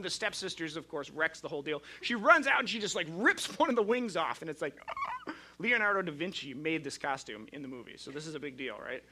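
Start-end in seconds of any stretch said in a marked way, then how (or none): 4.8-5.4 shocked gasp. Retold or imitated. Wing ripped off costume.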